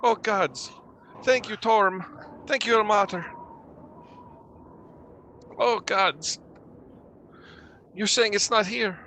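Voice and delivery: high-pitched, overwrought relief; relieved villager. Acted. Ash-covered villager asking if the danger is gone, shakily relieved. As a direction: thank the gods after a terror.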